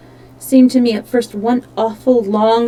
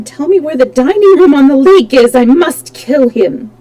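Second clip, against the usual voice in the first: angry; 4 semitones higher, 7 dB louder than usual. Acted. Raoul raging, demanding.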